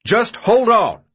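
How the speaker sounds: high, loud, slow yell. A narrator's reading. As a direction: stretch out a commanding shout. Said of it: "Albie yelled, silencing everyone".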